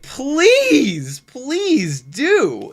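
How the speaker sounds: high, loud mock plea; mock pleading. Real, not acted. Telling toxic viewers to go away, mock pleading. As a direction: beg them to leave, sarcastically. Begging the haters to leave.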